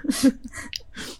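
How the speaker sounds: breathy held-in snicker; stifled giggle. Real, not acted. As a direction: let a giggle escape through the nose. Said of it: "我在憋笑".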